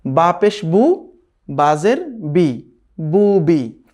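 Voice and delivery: slow, deliberate syllable spelling of বা পেশ বু; slow spelling drill. Shown as a demonstration. Teacher demonstrates vowel marks to students, slowly. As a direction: drag each syllable out for students to copy. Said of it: "তখন কি হবে?".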